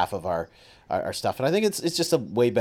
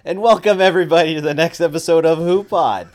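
loud, higher podcast host welcome; big host intro, pitched up; show-opening announcement; 5 semitones higher, 7 dB louder than usual. Real, not acted. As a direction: kick off the show at full volume.

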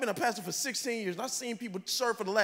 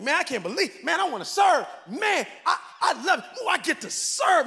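higher, louder, gushing about serving; mock excited volunteer; 6 semitones higher, 5 dB louder than usual. Pastor imitating a thrilled new server, excited. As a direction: overflow with giddy joy.

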